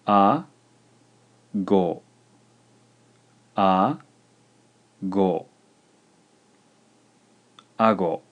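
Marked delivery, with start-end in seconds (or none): 0.1-5.5 A and go split by a long pause; measured dictation, syllables held apart; slow, syllable by syllable. Shown as a demonstration. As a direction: dictate A, then go, each syllable alone. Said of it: "slowly a couple times".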